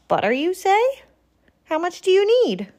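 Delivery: high and quick, eager to help; eager offer. Acted. Porcupine asks Bear how much butter, quick and eager. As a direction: jump in keen to share.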